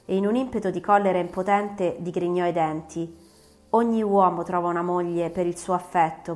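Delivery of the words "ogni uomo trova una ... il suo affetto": plain reading voice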